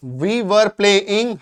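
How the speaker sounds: very slow, clear teacher model; slow model sentence. Shown as a demonstration. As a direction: spell it out word by word for learners. Teacher modelling example slowly.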